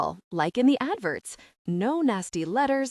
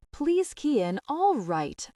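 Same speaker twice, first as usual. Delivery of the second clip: mild, higher-pitched yell; exasperated yell; 5 semitones higher than usual. A narrator's reading. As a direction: cut in, exasperated, without full volume. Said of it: "I yell".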